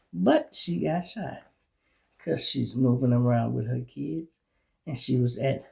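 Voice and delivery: normal talking voice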